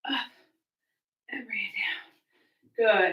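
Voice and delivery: breathless, whispered and slow; barely any air left for the cue; out of breath. Real, not acted. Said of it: "I'm really out of breath".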